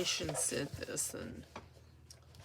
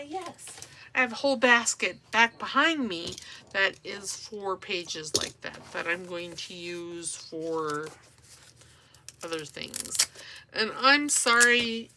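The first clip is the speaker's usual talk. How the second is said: ordinary delivery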